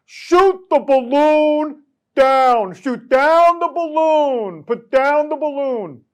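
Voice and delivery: high, loud, drawn-out mock yell; hammed-up shouted command; Donald Trump. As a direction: holler it slowly, stretching each phrase. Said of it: "Take a look at Donald Trump".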